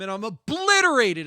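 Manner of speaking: ordinary talk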